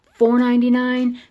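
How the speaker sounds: high, loud incredulous question; acted disbelief. Sloane learning what her sister's gift cost, loud mock disbelief. Retold shock at gift's value.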